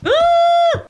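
sudden high loud yelp; startled yelp. Real, not acted. Gamer startled by a noise. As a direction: yelp in surprise.

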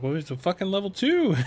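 high, loud put-on buddy voice; defensive gamer buddy. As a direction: squeak it out as a defensive friend. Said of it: "And he was like".

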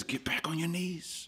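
unvoiced whisper of the Spirit; hushed whisper from the Spirit. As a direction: breathe it like a secret nudge. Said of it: "the Holy Spirit says".

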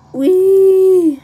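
high, loud joyful squeal; gleeful squeal. Real, not acted. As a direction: squeal with delight. Gleeful shout.

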